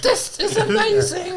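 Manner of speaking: high squeal of laughter; laughing. Real, not acted. Bursts out laughing while tasting.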